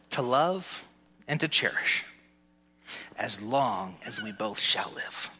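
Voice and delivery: measured vow, slowing to a close; wedding officiant. Acted. Officiant closing the groom's vows, slow and solemn. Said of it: "Repeat after me".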